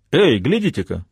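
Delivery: lively excited call; exclaiming. Acted. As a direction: point it out eagerly. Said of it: "воскликнул Арло".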